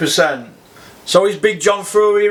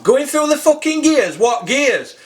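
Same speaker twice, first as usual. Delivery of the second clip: higher-pitched incredulous echo; scornful disbelief; 6 semitones higher than usual. Real, not acted. Fan challenging the commentator's gears remark, incredulous. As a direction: throw it back as a scornful question.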